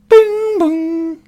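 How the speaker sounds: high sung bing bong chime; chime sound. Acted. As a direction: sing the two-tone ship chime. GM voices ship chime.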